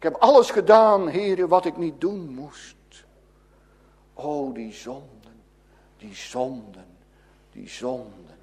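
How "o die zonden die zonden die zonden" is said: hushed lament. Real, not acted. Preacher grieving over sin, sinking to a hush.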